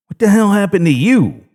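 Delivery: irritated demand; snapped. A narrator's reading. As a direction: snap at him angrily. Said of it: "I snapped".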